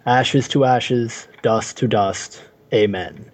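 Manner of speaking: slow, low graveside intoning; slow solemn funeral blessing; solemn vicar. Acted. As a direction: drag each word out like a vicar at a grave.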